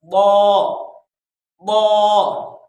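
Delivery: "बौ" as Arabic dad, loud and rounded; correct heavy dad. Shown as a demonstration. Teacher drilling the letter, "बौ" loud and emphatic. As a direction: press the tongue's side for a heavy "बौ". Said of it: "आपने बौद पढ़ना है इसको".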